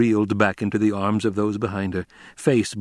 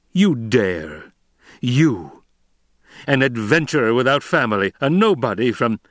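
outraged emperor; 3 semitones higher, 4 dB louder than usual. A narrator's reading.

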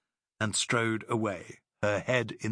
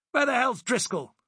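higher, louder furious demand; angry demand; 13 semitones higher, 5 dB louder than usual. Acted. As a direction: demand it hotly. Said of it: "demanded Fonthill".

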